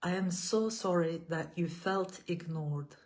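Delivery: measured, gentle affirmations with long pauses between. Acted. Leads repeat-after-me affirmations.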